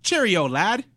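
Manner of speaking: high, loud English accent; attempted English accent. Shown as a demonstration. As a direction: call it out in a hammy English accent. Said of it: "like, English accent".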